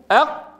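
أق stopped dead on a tightly closed ق; doubled qaf. Shown as a demonstration. Teacher drilling the articulation point, sealed ق in أق. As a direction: shut off all breath at the end of أق. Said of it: "مشددا، فنقول".

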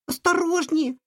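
very high squeak of a mouse; squeaky mouse. Acted. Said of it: "пропищала мышь". (писк мышки)

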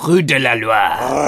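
slow, correcting French pronunciation; French accent. Shown as a demonstration. Correcting the co-host's attempt, slow French accent. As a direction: insist on the proper French. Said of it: "annoying French people".

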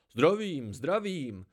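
put-on old man calls out warmly to the traveller; clear ringing call. A narrator's reading. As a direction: call out a hearty welcome. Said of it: "zavolal jasným a sytým hlasem".

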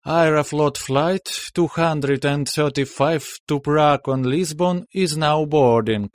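slow, high announcer English; boarding call over the terminal speakers; airport announcement. Acted. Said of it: "голос дикторши".

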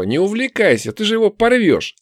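high-pitched warning; exclaiming elf. Acted. Elf warning the orc off the captive, high exclamation.

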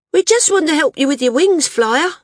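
put-on high, loud girl's voice; bold girl. A narrator's reading. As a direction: pipe up boldly as a young girl. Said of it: "the bolder of the girls said".

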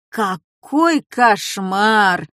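slow, drawn-out groan of dismay. Acted. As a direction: moan it in despair. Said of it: "застонал папа".

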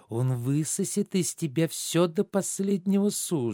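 ordinary talk

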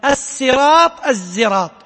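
الصراط with a slow drawn-out س, then الزراط with ز; reading with sin and zay. Shown as a demonstration. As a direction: contrast الصراط and الزراط clearly. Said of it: "وقرئ بالسين والزاي". (نطق الصراط بالسين ثم الزراط بالزاي)

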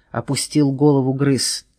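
ordinary delivery, nothing marked

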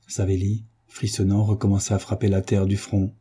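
usual speaking voice

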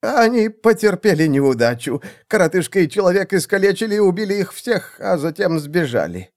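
high-pitched, anxious confession; nervous report. A narrator's reading. Assassin reports the failure to seer, nervous. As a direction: deliver bad news in a high voice.

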